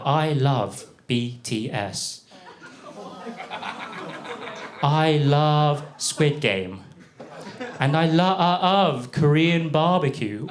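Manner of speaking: very slow, drawn-out declarations of love; imitating a date. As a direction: stretch each "love" with mock meaning. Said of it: "She said".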